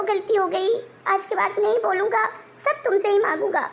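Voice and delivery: ordinary talk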